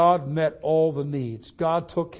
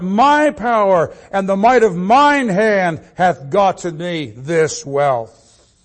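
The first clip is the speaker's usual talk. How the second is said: raised, louder preacher's declamation; forceful scripture reading; 4 semitones higher, 8 dB louder than usual. Acted. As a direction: declaim the verse to the congregation, higher and louder. Reads scripture aloud with force.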